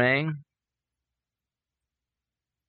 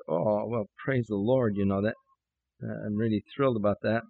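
discouraged; 4 semitones lower, 4 dB quieter than usual. Retold or imitated. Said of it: "I was just really discouraged".

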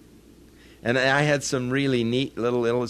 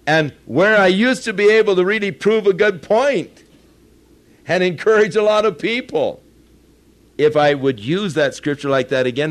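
higher, louder pulpit voice driving the point; full-force preaching; 8 semitones higher, 8 dB louder than usual. Real, not acted. Preacher warning congregation about misusing scripture, fervent preaching. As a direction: preach it out to the back pews.

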